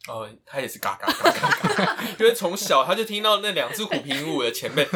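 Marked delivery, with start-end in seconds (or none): 0.8-2.0 comic cawing imitation; repeated crow-like caws; bird squawking. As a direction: mimic a budgie cawing. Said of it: "他也嘎了吗".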